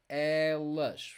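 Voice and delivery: "elas" with a long, wide-open first E. Shown as a demonstration. Teacher showing the feminine form, open E in "elas". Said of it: "Opens up the sound here".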